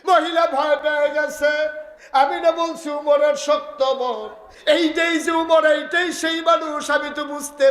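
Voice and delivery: high, impassioned preacher's cry; full-force preaching. Acted. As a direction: voice her shock at full pitch. Preaches the woman's alarm at full force.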